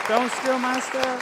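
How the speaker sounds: loud, high, drawn-out call. Real, not acted. Speaker to the clapping room as applause starts, calling out. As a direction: call it out slowly over applause.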